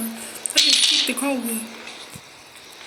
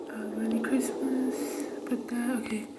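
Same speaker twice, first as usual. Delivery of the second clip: soft unvoiced whisper; 9 dB quieter than usual. Real, not acted. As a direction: whisper so nobody hears. Sneaking in a horror game.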